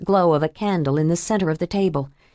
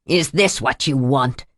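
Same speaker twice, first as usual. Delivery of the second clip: lower, cold taunt; menacing threat; 6 semitones lower than usual. Acted. Villain threatens with knife.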